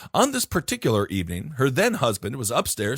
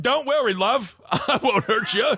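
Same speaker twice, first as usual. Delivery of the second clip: a high, hammed-up Lancashire coax, breaking into a laugh; Lancashire accent; 8 semitones higher than usual. Stranger at the door coaxing the frightened mother, high Lancashire accent. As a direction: sweet-talk her in a high Lancashire voice. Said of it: "in a strong Lancashire accent".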